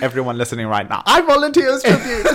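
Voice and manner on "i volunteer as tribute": high, very loud comic cry; mock volunteering shout. Offering oneself to everyone listening, mock volunteering shout. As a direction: shout it out, laughing, far above your usual pitch. Playful call to listeners, film quote.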